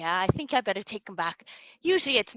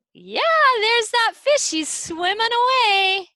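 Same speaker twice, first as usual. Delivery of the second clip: high, loud delighted shout; excited calling out; 11 semitones higher, 9 dB louder than usual. Pretend. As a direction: call out the sighting to little children. Calls out on spotting fish.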